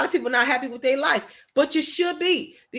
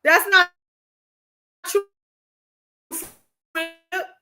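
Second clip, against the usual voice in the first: each word set apart by long pauses; 3 semitones higher than usual. Real, not acted. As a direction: let every word hang before the next. Slow spaced-out emphatic line.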